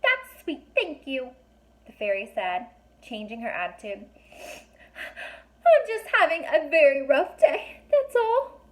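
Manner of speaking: very high, tiny, squeaky fairy voice; a little fairy snapping at strangers, high and squeaky. A narrator's reading. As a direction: speak like a fairy only inches tall. Fairy voice held.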